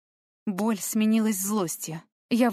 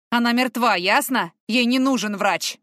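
louder, firm commanding shout; stern shout; 4 semitones higher, 6 dB louder than usual. Acted. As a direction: cut through her hysteria. Man shouting down hysterical girl.

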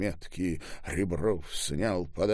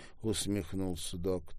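plain reading voice

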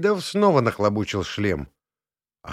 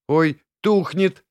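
slow, dismayed, higher; upset; 7 semitones higher, 5 dB louder, slower than usual. A narrator's reading. Inna watching the glow fade, slow dismayed cry. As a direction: let the disappointment show. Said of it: "расстроился Инкин голос".

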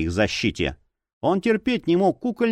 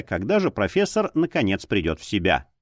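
ordinary delivery, nothing marked

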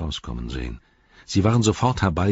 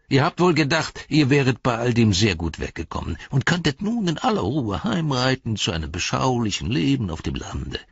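mocking; 6 semitones higher than usual. Saruman mocks hobbits.

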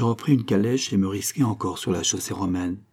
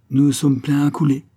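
matelot qui crie; 6 semitones higher, 6 dB louder than usual. A narrator's reading. Matelot crie sur le pont bondé.

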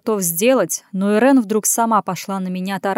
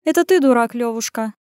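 higher woman's voice, dismissive; snorting retort; 3 semitones higher than usual. A narrator's reading. Woman answering his insult, dismissive snort. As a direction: toss the insult back, falling away on the name. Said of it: "фыркнула Ирен".

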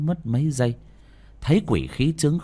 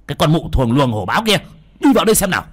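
fast, much louder, higher furious shout; hissing fury; 6 semitones higher, 8 dB louder, much faster than usual. Acted. Exorcist screaming at the woman to come inside, enraged. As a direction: spit the insult and order her inside. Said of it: "mà rít lên".

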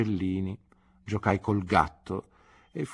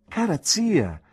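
higher, louder glad call to his aunt; 10 semitones higher, 5 dB louder, slower than usual. A narrator's reading. Nephew calling out in delight to his aunt.

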